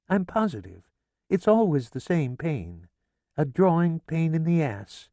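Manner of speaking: high, fussy mother voice; a mother fretting aloud in a high voice; chatty mother, pitched high; mother's voice. A narrator's reading. Mother complaining to husband.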